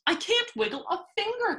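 very high storybook wail; acted cry of distress. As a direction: wail it helplessly. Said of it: "the trumpeter wailed".

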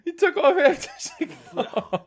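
cracking up mid-sentence; high laughing disbelief. Real, not acted. Friend bit off half cigar.

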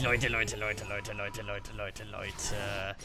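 low, soft, fading groan; despairing mutter. Real, not acted. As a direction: trail off in despair. Dying, despairing.

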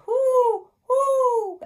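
very high, loud, long hoots; owl hoot. A narrator's reading. Teacher reading the owl's part at nighttime, high hooting. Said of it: "asked the owl".

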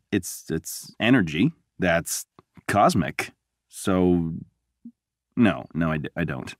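low, slow, halting bluff that gives up; floundering. Acted. Bluffing to the doc about cosmic energy, floundering. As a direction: grope for an explanation, then sheepishly concede. Bluffs, then admits not knowing.

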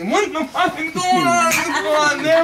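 very high, loud, outraged yell; freaking out. Acted. Guest after a surprise enema, freaking out. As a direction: shriek in shocked outrage. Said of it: "the guy's fucking freaking".

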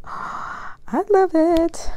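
delighted. Real, not acted. Gasps over gift.

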